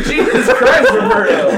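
high-pitched, laughing protest; exasperated disbelief. Retold or imitated. Worker retelling his protest to Roberto, exasperated. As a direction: squeal it in mock exasperation. Retold protest at coworker's swearing.

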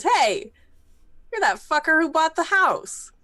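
loud, high angry call-out; angry accusation. Acted. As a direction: confront him hotly. Angry confrontation over house.

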